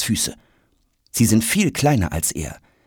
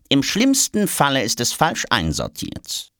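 pitched-up didactic correction; lecturing; 6 semitones higher than usual. A narrator's reading. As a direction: inform him like a know-all official. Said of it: "belehrt ihn die Diplomatentasche".

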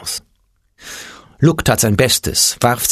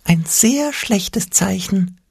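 Angstpeter whispering; 10 semitones higher, much slower than usual. A narrator's reading. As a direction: hush it like a timid imp with bad news. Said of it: "flüsterte der Angstpeter".

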